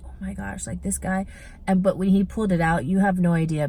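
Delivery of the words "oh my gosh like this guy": hushed inner thought; inner thought, quiet. Retold or imitated. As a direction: think it under your breath. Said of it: "I'm just thinking".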